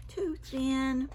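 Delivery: ordinary delivery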